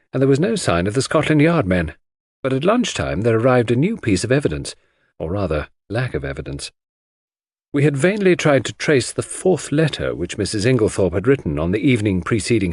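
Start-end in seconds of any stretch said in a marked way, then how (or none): none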